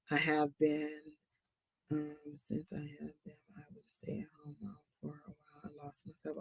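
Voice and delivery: hushed whisper, barely voiced. Real, not acted. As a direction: whisper it close, almost under the breath. Quietly reading out viewer's message.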